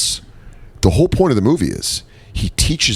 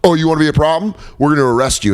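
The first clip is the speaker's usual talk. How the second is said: threatening cops; 6 semitones higher, faster than usual. Acted. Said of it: "And then they're like".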